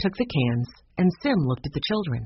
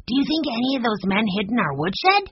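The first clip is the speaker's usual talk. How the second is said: lightly voiced high boy; eager young Benny; 7 semitones higher than usual. A narrator's reading. Benny asking his brother about the woodshed, eager. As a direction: ask it high and curious.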